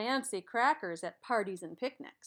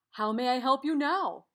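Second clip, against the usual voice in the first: louder, distinct shapeshifter voice; 2 semitones higher, 7 dB louder than usual. Acted. Shapeshifter welcomes the quadrilateral back, louder character voice. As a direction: greet the customer as the magical shapeshifter.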